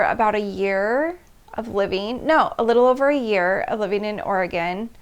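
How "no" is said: ordinary talk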